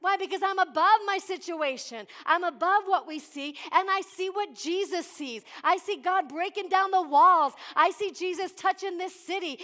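loud, fervent sermon delivery; preaching. Acted. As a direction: preach it out to the whole church. Preaches at full force.

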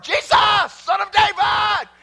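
high, loud shout, building to a peak; shouted cry for help. Acted. Preacher voicing a blind man calling to Jesus, shouting. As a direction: cry out at full voice, well above talking pitch.

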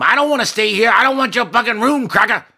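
shrill high furious shout; drunk rage. Acted. Tiny drunk woman snapping at the manager's ma'am. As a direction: spit it out full of drunken spite.

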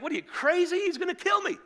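very high, loud protest; panicked incredulity. Acted. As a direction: squawk back in comic disbelief. Jacob protests God's command.

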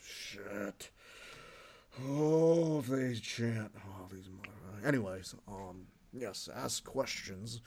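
a lightly voiced Vince muttering soft; Vince McMahon muttering aside. Vince mutters his real feelings about the fans, soft. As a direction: grumble under the breath as Vince. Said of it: "my favorite Vince".

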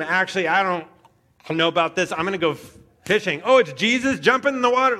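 hammed-up headlong enthusiasm; impulsive. Impulsive Peter keeps changing his mind, hammed-up eagerness. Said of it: "Peter is the quintessential person that says".

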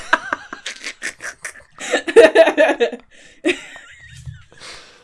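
loud, high, helpless laughing; big burst of laughter. Real, not acted. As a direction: laugh long and hard at the joke. Bursts out laughing after song.

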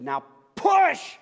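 loud high shout of one word; yelled command. Retold or imitated. Doctor yelling at Megan to push one last time, full shout. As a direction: yell it like the last chance. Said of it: "the doctor yelled at Megan".

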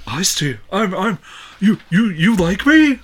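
high goofy mock voice, stammering; silly clown. Mocking voice, high, far.

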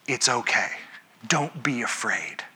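soothing angel. Retold or imitated. Angels calming frightened people.

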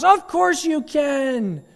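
very high, slow cheer; cheering crowd. Acted. Crowd cheers the daredevil again, high slow. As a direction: gush like a fan. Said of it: "the crowd that cheers".

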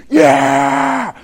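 high shouted cheer; crowd cheer. Retold or imitated. Preacher voicing the cheering army, shouted yeah. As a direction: roar it like a rowdy crowd.

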